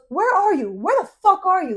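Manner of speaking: imitated furious caller, high and loud; getting angrier. Friend calling lost driver again, angrier and angrier. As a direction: demand it, acting out rising anger. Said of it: "he's getting angrier and angrier".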